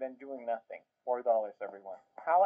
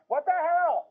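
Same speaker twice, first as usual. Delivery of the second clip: high, loud what-the-hell cry; shocked outburst; 13 semitones higher, 6 dB louder, slower than usual. Real, not acted. Reacting to the dog story, a high loud shocked cry. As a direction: recoil from the clip, loud and high.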